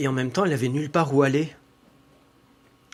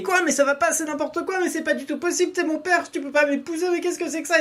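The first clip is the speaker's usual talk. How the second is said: put-on high, indignant girlish cry, pitched way up; shocked outcry. Daughter rejecting her father's proposal, high shocked outcry. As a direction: refuse outright, voice shooting up in outrage. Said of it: "il s'est mis à crier".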